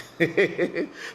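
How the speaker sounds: high burst of laughter; real laugh. Real, not acted. Host laughing at a viewer's question, rising laugh. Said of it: "That is why I laugh".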